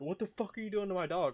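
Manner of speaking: high, rapid mimicked outrage; frantic retold accusation; owner freaking out. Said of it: "owner's coming over freaking out like".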